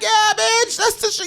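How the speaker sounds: high, shouted taunt; gloating shout, high up; hyped shout. Retold or imitated. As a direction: crow over what you just did. Hyping it up, real Detroit.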